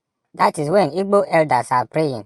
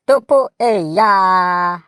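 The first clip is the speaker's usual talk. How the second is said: usual speaking voice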